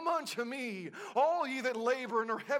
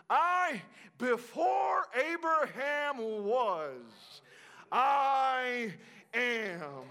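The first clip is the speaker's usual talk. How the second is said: climactic preaching cry; much slower than usual. Acted. Preacher crying out Jesus' words to the crowd. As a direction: cry it out slowly.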